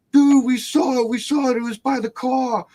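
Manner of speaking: high-pitched panicked little brother; kid accent. Retold or imitated. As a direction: imitate a frightened child reporting breathlessly. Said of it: "a kid accent".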